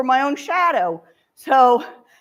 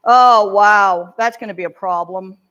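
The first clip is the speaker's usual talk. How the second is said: loud "oh, wow," then sinking lower; mock alarm settling into worry; dismayed teacher; 4 semitones lower, 8 dB louder than usual. Shown as a demonstration. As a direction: react with acted dismay. Acts teacher dismayed by struggles.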